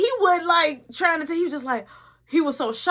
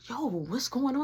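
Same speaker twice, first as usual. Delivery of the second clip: soft hushed question; 8 dB quieter than usual. Retold or imitated. Sneaking a question to Mark at the table, hushed.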